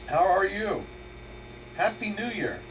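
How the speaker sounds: normal talking voice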